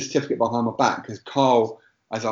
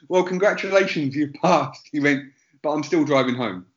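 put-on light Indian English accent, higher pitched; a lighter touch of the Indian accent; 3 semitones higher than usual. Driving instructor dryly insisting on driving home, light Indian accent. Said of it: "the Indian accent".

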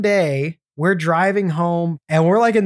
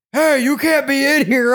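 clerk telling off; 8 semitones higher than usual. Retold or imitated. Liquor store clerk to a young boy, higher mock voice. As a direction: bark it like a clerk shooing a kid. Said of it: "He's like".

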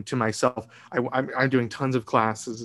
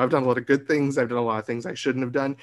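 ordinary talk

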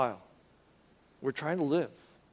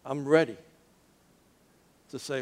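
ordinary talk, nothing marked

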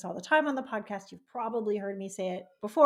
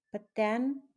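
ordinary talk

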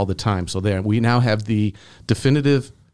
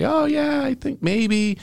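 higher-pitched, offhand mock reply; mock a casual, unthinking go-ahead; willy-nilly; slower than usual. Mocking careless phone searches, willy-nilly. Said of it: "You can't just willy-nilly say".

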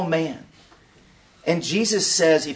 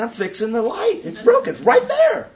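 mock clueless voice. Naive answer to driver Ryan.

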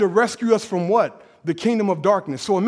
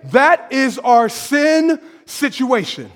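ordinary talk, nothing marked

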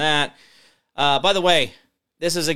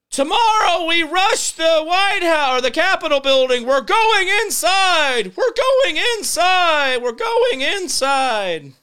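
high, loud drawn-out crowd-rousing yell; mock agitator hollering a slogan; mock rabble-rousing shout; 14 semitones higher, 5 dB louder, slower than usual. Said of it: "kind of paid actors that say".

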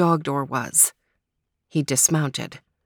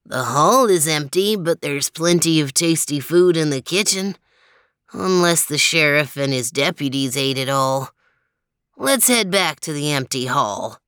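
low character voice; 3 semitones lower than usual. Acted. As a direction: voice a calm dog character. Character dialogue in story.

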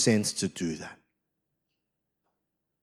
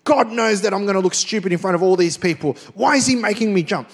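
mock complaining, higher and louder, fast; rebellious whining; 7 semitones higher, 5 dB louder than usual. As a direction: grumble at God like a sulky rebel. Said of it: "in the rebellious spirit".

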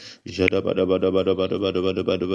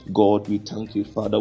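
ordinary talk, nothing marked

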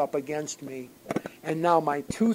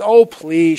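daughter protesting; 15 dB louder than usual. Retold or imitated. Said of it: "She says".

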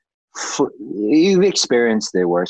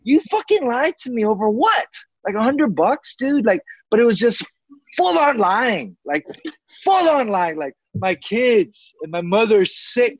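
high-pitched outraged rant; worked-up indignation, pitch held high; real anger; 9 semitones higher than usual. Real, not acted. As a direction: vent disbelief at being lied to. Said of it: "I get so angry".

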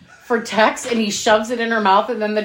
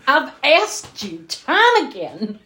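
higher, slow whiny hint of Tex; imitating Tex sulking; 6 semitones higher, slower than usual. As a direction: drag it out like a sulky grown man. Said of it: "He's like".

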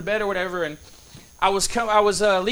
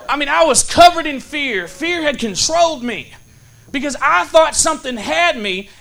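raised, higher and louder pulpit voice; impassioned preaching; 6 semitones higher, 6 dB louder than usual. Real, not acted. Preacher applying his fear story to the congregation, impassioned preaching. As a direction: drive the lesson home with force.

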